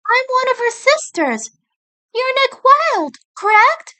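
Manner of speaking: put-on high young female rabbit voice, polite and direct; sister rabbit voice. Judy's sister introduces herself to Nick, high polite voice. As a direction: introduce yourself to a stranger at a grave.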